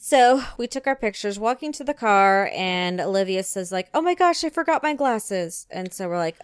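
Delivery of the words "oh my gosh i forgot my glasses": put-on high, alarmed little-girl gasp; mock panic. Mother imitating daughter in sudden alarm. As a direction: squeal it like a forgetful kid.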